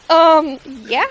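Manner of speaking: loud, affronted "um"; mock offense. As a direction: act offended, hitting the first word hard. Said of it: "like, mock offense".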